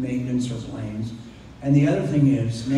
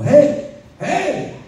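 calling out; 8 dB louder than usual. Retold or imitated. Calling after her down hall.

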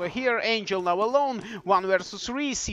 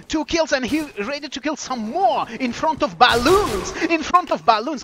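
higher, excited caster call; excited play-by-play; 5 semitones higher, 5 dB louder than usual. Real, not acted. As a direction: build excitement as the kills come in. Calling live kills in match.

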